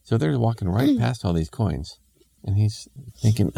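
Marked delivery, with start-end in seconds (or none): none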